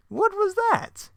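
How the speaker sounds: high, slow sneering question; Joker voice. Acted. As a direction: stretch it out like a cartoon villain.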